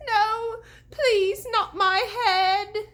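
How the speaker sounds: high, loud sobbing plea; begging in tears. A narrator's reading. Crying deputy pleading with the ghost, high sobbing beg. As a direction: blubber and beg for your life. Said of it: "he begged".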